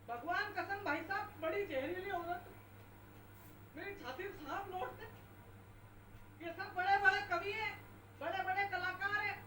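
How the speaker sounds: normal talking voice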